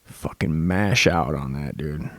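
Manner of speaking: mock a dumb bro hyped about her. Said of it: "watch Kathy Bates and go".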